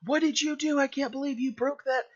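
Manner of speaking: shocked, angry parent yelling; angry parent outburst. Acted. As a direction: blow up at the child over the broken thing. Parent discovers broken valuable.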